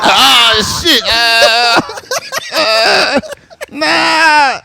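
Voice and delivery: high, loud, stunned exclamation; shocked. Retold or imitated. Seeing his friend's brother, in a high loud shocked voice. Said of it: "I'm like".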